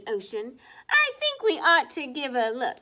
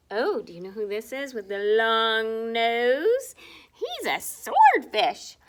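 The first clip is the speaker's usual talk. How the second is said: plain reading voice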